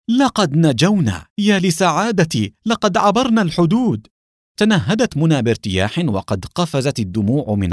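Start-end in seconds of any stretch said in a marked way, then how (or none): 0.1-4.1 overjoyed cry. Acted. Alexei celebrating crossing the border, overjoyed cry. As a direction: savour the escape, rising with joy. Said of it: "صاح أليكسي بسعادة غامرة".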